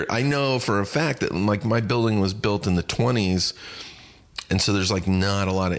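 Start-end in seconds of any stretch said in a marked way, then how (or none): none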